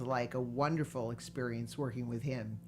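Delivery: usual speaking voice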